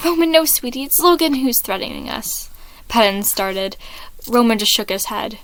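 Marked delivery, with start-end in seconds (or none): 0.1-2.5 tender dad voice. Acted. Patton comforting Roman.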